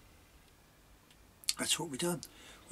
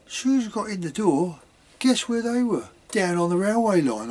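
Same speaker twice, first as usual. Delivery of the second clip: loud telling-tales; 6 semitones higher, 10 dB louder than usual. Retold or imitated. Mum telling dad, retold loudly.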